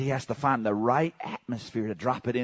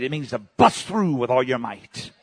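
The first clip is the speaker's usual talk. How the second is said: ordinary talk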